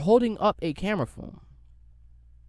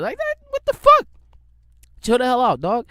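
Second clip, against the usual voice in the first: shouted in outrage, voice leaping up; outraged shout; 8 semitones higher, 8 dB louder than usual. Real, not acted. Ranting at the old heads, an outraged shout. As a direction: yell at them in disbelief.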